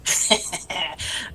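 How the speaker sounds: a wicked little laugh; evil laugh. Real, not acted. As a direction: chuckle like a schemer. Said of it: "That was an evil laugh".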